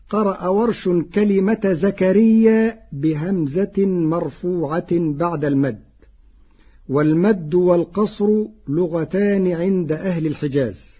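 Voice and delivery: ordinary talk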